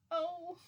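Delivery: high-pitched drawn-out "oh"; high imitated moan of "oh"; imitated "oh" noise. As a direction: mimic his high "oh" sound. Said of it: "He made, like, a "Oh" noise".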